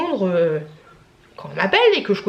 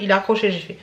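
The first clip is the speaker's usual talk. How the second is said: ordinary talk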